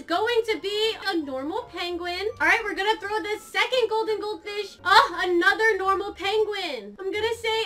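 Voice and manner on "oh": normal talking voice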